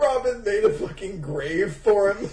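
laughing. Real, not acted. Laughing hard at the scene.

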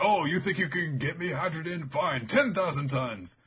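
mock villain challenge; taunting fighter. Villain taunting, calling out attack.

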